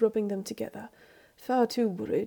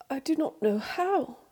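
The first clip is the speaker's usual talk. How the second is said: higher, exasperated complaint; cross; 5 semitones higher than usual. A narrator's reading. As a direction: snap it out crossly. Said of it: "he says crossly".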